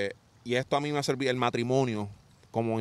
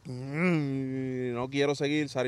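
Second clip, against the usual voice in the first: retold discouragement; 5 dB quieter, much slower than usual. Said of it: "estoy como que arrochado".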